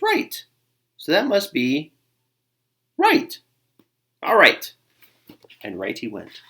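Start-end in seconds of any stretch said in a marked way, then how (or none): 0.0-4.7 self-convinced. Acted. Buddy talking himself into it.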